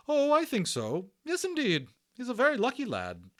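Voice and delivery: high loud brisk nurse voice; a bustling hospital matron; hearty Matron. Acted. Matron reassuring Omri about the Indian, high and hearty.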